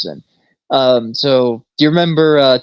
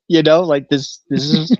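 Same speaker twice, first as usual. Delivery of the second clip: ordinary talk, nothing marked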